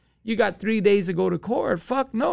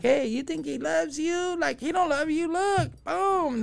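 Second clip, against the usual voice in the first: higher, taunting cop trying to break her; mocking cop voice; 8 semitones higher than usual. Cops taunting his baby's mom, higher mocking voice. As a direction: needle her like a smug cop.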